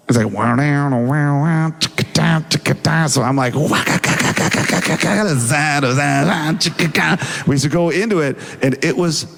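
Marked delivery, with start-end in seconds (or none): 0.3-3.1 mouthed rock guitar noises; imitated guitar riff. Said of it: "starts playing A Whole Lotta Love". 3.6-7.2 rapid-fire mouthed drum noises; beatbox a wild rock fill; imitated drum fill. Imitates own drumming.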